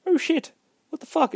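high, startled yelp; shocked yelp. Retold or imitated. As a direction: jump up in pitch in shock. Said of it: "And Rocco's just like".

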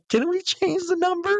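very high, mock-innocent squeak; feigned innocence. Cheating narrator asking girlfriend, squeaky fake innocence. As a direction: play dumb in a high little voice.

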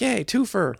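high, delighted yay of recognition; mock cheer. Retelling spotting a sitcom actor on screen, high mock cheer.

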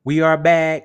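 booming welcome back to the show; loud we are back. Acted. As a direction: announce the return like a host. Return announcement, loud.

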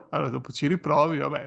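ordinary talk

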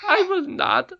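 high, loud squeaky refusal; stubborn goblin queen. A narrator's reading. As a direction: refuse flatly.

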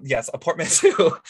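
ordinary talk, nothing marked

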